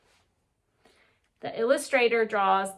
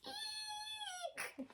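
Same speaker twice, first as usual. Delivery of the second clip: tiny, very high and soft squeak; high breathy cartoon squeak; squeaky eek; 20 dB quieter than usual. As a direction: squeak it out like a startled little girl. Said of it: "And she's saying".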